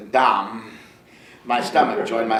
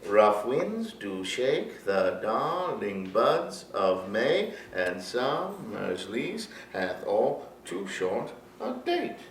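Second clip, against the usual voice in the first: slow, softer poetic reading; slow soft recital; 7 dB quieter, slower than usual. Shown as a demonstration. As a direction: let the lines drift softly and slowly. Continues reciting the sonnet.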